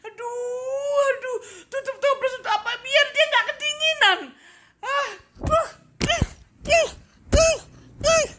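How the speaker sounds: high, hammed-up fake sobbing; mock crying. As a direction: whine like a crybaby. Mock crying over question. (pura-pura menangis)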